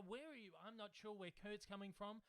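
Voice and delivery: usual speaking voice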